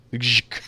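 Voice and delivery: Gzhk softly, all consonants; vowelless name pronunciation. Shown as a demonstration. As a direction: buzz Gzhk softly and stop on the k. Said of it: "How do you pronounce that name".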